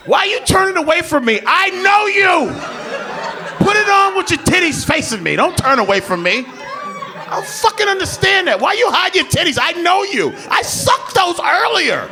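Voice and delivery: mock indignant yelling. Boyfriend yelling at girlfriend for hiding, hammed-up.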